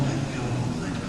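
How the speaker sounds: "let go, let go" dropped to a soft whisper. Shown as a demonstration. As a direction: breathe "let go" out like a release. Repeats let go, fading.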